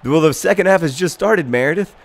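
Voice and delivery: usual speaking voice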